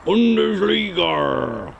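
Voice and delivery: Bundesliga stretched into a pirate's arrr; long, drawn-out pirate Bundesligarrr. Acted. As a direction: growl out Bundesliga like a pirate, very slowly. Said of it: "you sound like a pirate".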